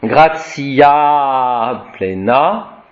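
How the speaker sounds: "Gratia" with a long final A, very slow; "Gratia plena" slow, long A held. Shown as a demonstration. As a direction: drag out the last A of "Gratia" and "plena". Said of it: "C'est un A long".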